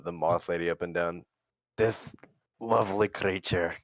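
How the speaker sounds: slow, solemn wedding address; officiant speech. Acted. Rocky officiates the odd kitchen wedding in a solemn, slow voice. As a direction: preside over it gravely and slowly.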